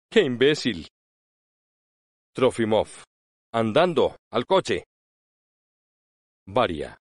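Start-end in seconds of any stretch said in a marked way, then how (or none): 0.1-0.9 contemptuous. A narrator's reading. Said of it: "con desprecio".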